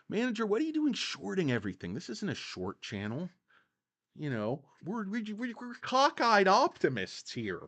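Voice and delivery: brisk, hammed-up channel host chiding the manager; mock scolding host. Host scolding the manager for shorting, mock indignant. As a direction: scold him like a cheery cheerleader for stocks.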